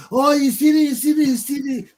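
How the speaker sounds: high, loud excited cries of the crowd; crowd shouting. Retold or imitated. Onlookers spotting a thief, high loud shouting. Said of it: "everybody just hear".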